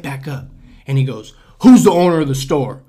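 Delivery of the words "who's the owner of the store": loud, pushy demand for the owner; demanding customer. Retold or imitated. Angry customer demanding to see the store owner, loud.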